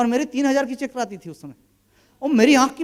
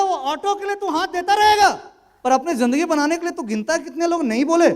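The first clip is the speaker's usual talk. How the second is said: pointed challenge; 7 semitones higher than usual. Retold or imitated. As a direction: push him with a raised, pointed question. Senior's pointed life lesson.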